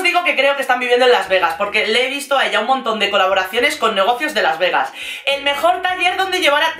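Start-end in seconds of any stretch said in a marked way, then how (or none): 5.3-6.7 high, singsong ad pitch; chirpy mock influencer plug; mimicking influencer promo. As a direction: parody a promo post, pitched up.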